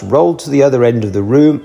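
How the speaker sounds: ordinary delivery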